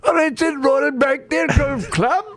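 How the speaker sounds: mock Cockney-ish British golf announcer; mock British accent. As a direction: call the golf shot in a put-on British accent. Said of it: "you're leaning Cockney".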